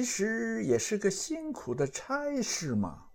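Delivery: high, soft, drawn-out character voice; Monkey King's dawning realization. Acted. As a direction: stretch the words out in a thin, high voice, marvelling. Voices Sun Wukong's discovery.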